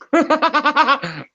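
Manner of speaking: long, high burst of real laughter; high peal of laughter; cracking up. Real, not acted. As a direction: laugh hard, unable to hold it in. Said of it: "I'm cracking up".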